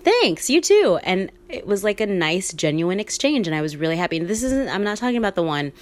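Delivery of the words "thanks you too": high, loud, chirpy thanks; imitated polite, sing-out reply; acted friendly reply. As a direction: return a neighbor's greeting in a high, eager voice. Acted cheerful reply to the neighbor.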